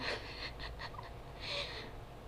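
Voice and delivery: high breathy giggle. Real, not acted. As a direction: burst into giggles. Reader breaks into laughter.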